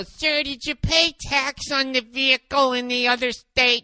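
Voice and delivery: slow, prim clerk voice put on; mock DMV clerk. DMV clerk quizzing the angry customer, slow and prim. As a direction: drawl it like a bored official. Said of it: "And then they go".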